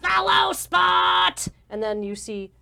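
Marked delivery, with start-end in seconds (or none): 0.0-1.5 high, drawn-out goblin shout; shouted lighting cue. Acted. Goblin in the control booth testing spotlights, shouting. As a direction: holler it slowly to the stage crew. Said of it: "calling out lighting cues".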